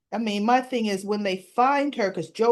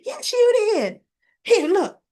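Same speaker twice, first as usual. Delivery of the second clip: much higher, louder "Yes, you did."; insistent shout; 11 semitones higher, 5 dB louder than usual. Retold or imitated. Allison shouting at Chelsea about the messages, high and loud. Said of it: "And she says".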